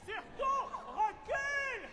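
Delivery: high urgent warning; hammed-up cry to back off; b-movie acting.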